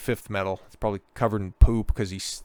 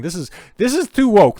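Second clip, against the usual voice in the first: mock outraged exec; 9 semitones higher, 7 dB louder than usual.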